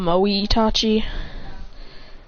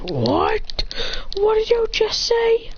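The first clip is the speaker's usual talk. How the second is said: high-pitched, strained challenge; incredulous anime fighter; acted disbelief; 14 semitones higher, much slower than usual. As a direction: demand it in a high, stretched-out voice. Character's outraged reply in fight.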